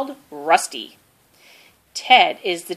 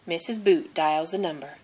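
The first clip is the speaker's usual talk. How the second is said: plain reading voice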